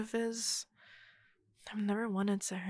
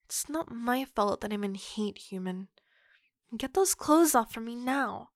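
ordinary talk

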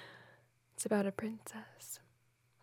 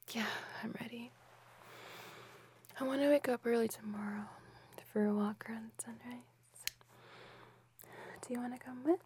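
soft sleepy whisper; 3 dB quieter, faster than usual. Acted. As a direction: breathe it close and hushed. Whispered girlfriend roleplay.

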